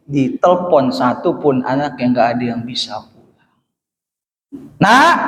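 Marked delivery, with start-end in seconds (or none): 4.8-5.2 put-on raised, higher call to a child. Father phoning his eldest in Germany, calling out. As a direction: call your child over the phone, louder.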